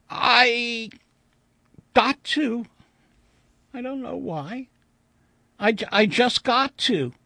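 put-on high, halting child voice; insistent little girl. A narrator's reading.

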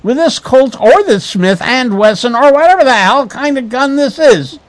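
high-pitched blustering pulp threat; theatrical gun-waving bluster; cried threat. A narrator's reading. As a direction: cry it out, hammed up and rambling. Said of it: "he cried".

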